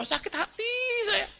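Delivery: very high, wounded cry; mock wail. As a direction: squeal it out like an offended woman. Voicing offended woman crying.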